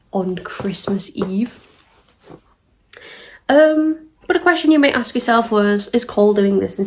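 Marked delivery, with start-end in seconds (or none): 0.1-1.7 hushed, scandalized whisper; a whisper slowed right down. Real, not acted. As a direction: breathe it out in disbelief.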